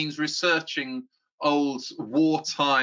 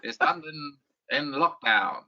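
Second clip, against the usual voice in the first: put-on clipped old English accent. Shown as a demonstration. Filmmaker showing how he voices wartime newsreel pastiche, clipped old English accent. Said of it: "that clipped kind of old English accent".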